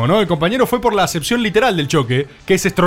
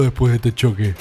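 low-pitched, mildly deflated reaction; hopeless; 8 semitones lower than usual. Real, not acted. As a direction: sound let down and hopeless. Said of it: "como desesperanzado".

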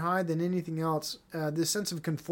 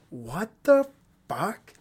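incredulous; much slower than usual. Real, not acted. Incredulous outburst.

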